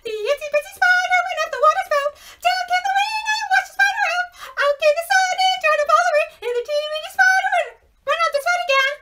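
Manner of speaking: very high, fast squeaky sung voice; teeny spider voice. Shown as a demonstration. Teacher sings as the teeny weeny spider, very high and fast. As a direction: sing it tiny and quick like a wee spider. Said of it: "he has a really, really high voice".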